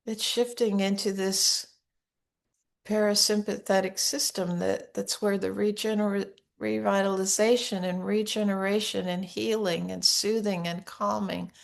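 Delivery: soft, unhurried meditation guidance; guided meditation. Acted. Teacher to listeners with hands on hearts, soft guided meditation. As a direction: guide them gently into calm and safety.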